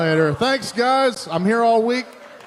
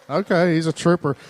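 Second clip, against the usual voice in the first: ordinary talk, nothing marked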